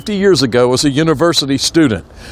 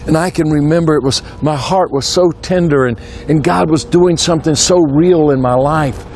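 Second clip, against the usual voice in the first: ordinary delivery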